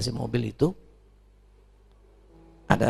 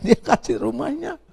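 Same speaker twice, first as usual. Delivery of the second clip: much higher, laughing through the words; 13 semitones higher, 4 dB louder than usual. Real, not acted. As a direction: laugh with disbelief at the gift. Laughs telling aunt gave house. (sambil tertawa, nada jauh lebih tinggi)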